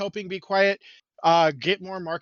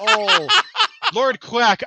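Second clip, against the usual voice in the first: surprised; 13 semitones higher, 8 dB louder, much slower than usual. Real, not acted. Apologizing to a listener.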